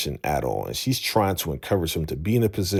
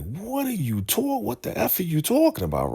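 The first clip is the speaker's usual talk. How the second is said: high, fast indignant protest; outraged disbelief. Retold or imitated. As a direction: squeak out stunned outrage. Man punched, protesting.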